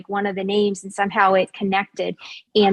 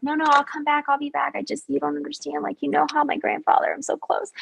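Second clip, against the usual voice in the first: high, softened mock pleading; putting on the works; 5 semitones higher, 3 dB quieter than usual. Woman talking her way out of there, put-on high pleading. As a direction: plead your excuse in a raised, quieter voice, laying it on. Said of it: "I'm putting on the works".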